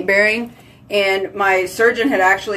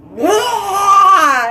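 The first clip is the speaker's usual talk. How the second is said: mock wail; 12 semitones higher, 6 dB louder than usual. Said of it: "I'd be like".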